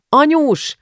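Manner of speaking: high shouted call for mother; girl bursting in, shouting for her mother. Acted. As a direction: yell for mom from the hallway. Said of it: "kiabálta már az előszobában".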